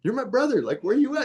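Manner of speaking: high, half-joking call-out to a brother who might bolt; hammed-up high voice daring brother not to run; mock challenge to brother. Retold challenge to brother on walk.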